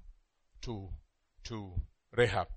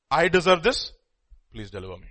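humble confession; 3 dB louder than usual. Shown as a demonstration. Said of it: "There's a lif-, difference".

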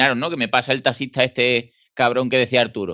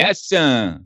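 wounding insult; 3 semitones higher than usual. Shown as a demonstration. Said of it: "depende de la entonación que le des".

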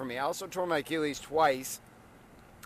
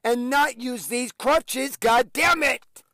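loud, higher and slow frustrated yell; 10 semitones higher, 6 dB louder, much slower than usual. Retold or imitated. On crutches, yelling how badly he wants to run, loud. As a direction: roar your frustration slowly. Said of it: "I'm like".

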